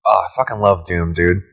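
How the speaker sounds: ordinary talk, nothing marked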